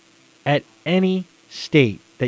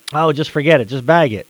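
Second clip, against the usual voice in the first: dismissive; 8 dB louder than usual. Retold or imitated. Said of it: "What do you do?".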